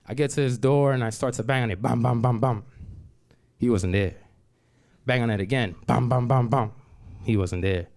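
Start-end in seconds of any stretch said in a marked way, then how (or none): 1.8-2.6 knocking sounds. Retold or imitated. Banging on his office door. 5.9-6.7 knocking sounds. Retold or imitated. Banging on the door again.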